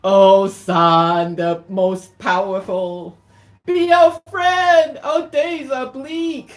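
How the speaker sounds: slow, booming call climbing to a high cry; loud invocation rising high; loud plea. Acted. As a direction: call out to the heavens, stretching each word. Mice plead to the sun.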